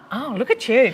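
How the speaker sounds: ordinary talk, nothing marked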